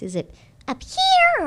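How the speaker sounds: very high, loud, slow voice; very high placement. Shown as a demonstration. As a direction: push it way up high. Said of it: "that means it's this place".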